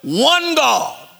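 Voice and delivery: slow, high, ringing sermon chant; preaching. Acted. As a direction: proclaim each "one" like a creed.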